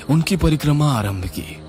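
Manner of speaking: usual speaking voice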